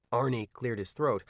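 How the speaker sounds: usual speaking voice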